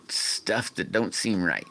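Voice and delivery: ordinary talk, nothing marked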